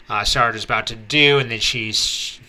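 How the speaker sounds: normal talking voice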